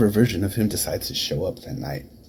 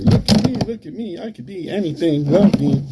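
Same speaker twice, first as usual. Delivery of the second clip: high-pitched, needy show-off impression; mocking jester; 10 semitones higher, 3 dB louder than usual. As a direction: mimic an attention-begging clown, pitched way up. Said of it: "Like he's a fucking jester".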